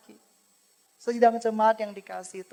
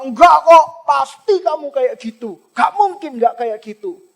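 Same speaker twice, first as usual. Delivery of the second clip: harsh insistence; 4 semitones higher, 10 dB louder than usual. Pretend. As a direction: pretend Eli shouting her down, louder and higher. Eli insists harshly, pretend scenario. (Eli membentak dengan keras, tidak mau mendengar)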